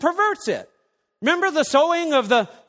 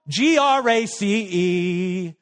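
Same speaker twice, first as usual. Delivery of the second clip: spelled out slowly; 4 semitones lower, much slower than usual. Shown as a demonstration. Spelling out grace.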